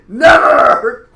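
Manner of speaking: full-volume, high defiant scream; explosive, drawn-out yell. A narrator's reading. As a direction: scream the refusal out long and loud. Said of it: "he screamed".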